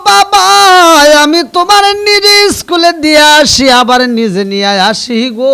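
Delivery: high, very loud chanted appeal; impassioned mother's plea. Acted. Mother tells son she takes him to school, impassioned plea. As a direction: cry out to the child in sermon chant.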